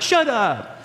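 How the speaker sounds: high-pitched, incredulous mock exclamation; mock disbelief. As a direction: imitate a cabbie who can't believe it. Cabbie's disbelief at hearing he's a pastor.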